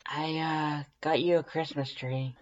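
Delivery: sheepish. Acted. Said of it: "she says quietly".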